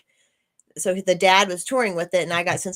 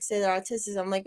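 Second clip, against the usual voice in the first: ordinary delivery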